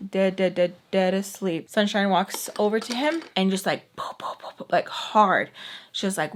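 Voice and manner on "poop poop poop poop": quick, unvoiced popping smack noises; imitated smacks. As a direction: pop out a run of little hitting sounds. Imitates hard smacks on sleeper.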